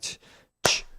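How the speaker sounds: short soft "ch" noise; spaced percussive "ch" hits; imitated clap. Shown as a demonstration. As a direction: mouth a clap on the beat. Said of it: "people will clap on beat three".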